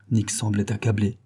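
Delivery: ordinary delivery, nothing marked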